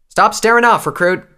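high, loud reprimand to a recruit; furious officer's shout; angry Tailwind. A narrator's reading. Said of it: "with an angry expression".

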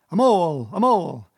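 loud, high, falling battle shout; war cry. A narrator's reading. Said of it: "shouting his awful war cry".

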